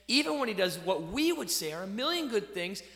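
normal talking voice

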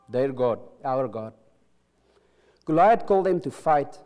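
normal talking voice

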